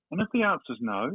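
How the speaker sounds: normal talking voice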